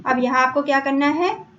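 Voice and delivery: usual speaking voice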